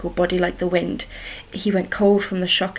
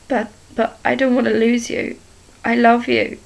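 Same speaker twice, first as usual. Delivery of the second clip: higher, louder tearful plea; crying; 4 semitones higher, 5 dB louder than usual. A narrator's reading. Henrietta crying to her ghostly love, tearful. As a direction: break into tears on love. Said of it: "she cried".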